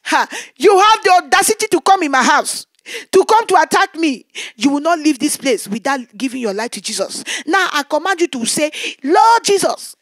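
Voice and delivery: loud, forceful rebuke of an intruder; preacher ordering the demon to say Lord Jesus, loud; commanding rebuke. Retold or imitated. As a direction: stand over him and command. Confronting the kneeling intruder.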